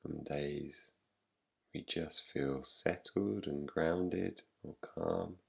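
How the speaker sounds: soft, slightly low voice with long pauses; guided meditation. Real, not acted. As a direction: guide them gently into rest, leaving long silences. Leads a yoga nidra practice.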